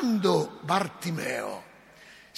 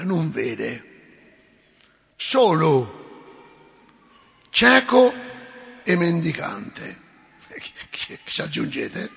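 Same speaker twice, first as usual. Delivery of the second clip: ordinary talk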